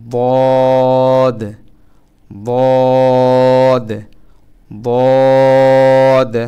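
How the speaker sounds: বদ loud and heavy, filling the mouth; full-mouthed dad. Shown as a demonstration. Teacher drilling Arabic dad, loud and heavy. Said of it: "মুখ ভর্তি করে".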